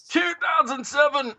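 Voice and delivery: slow, strained under a weight. Real, not acted. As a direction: squeeze it out while lifting. Still holding the heavy PC.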